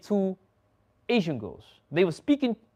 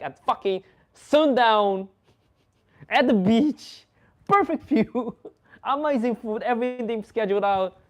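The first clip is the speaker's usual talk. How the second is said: excited; 7 semitones higher, 5 dB louder than usual. Real, not acted. Bragging about the perfect date.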